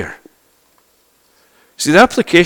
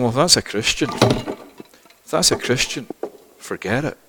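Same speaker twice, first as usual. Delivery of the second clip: dismissive onlooker; 2 semitones lower, 9 dB quieter than usual. Acted.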